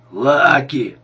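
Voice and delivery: loud, drawn-out holler; drunkenly hollered greeting; drunken yell. A narrator's reading. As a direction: bellow a name like a drunk calling a pal. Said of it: "заорал он".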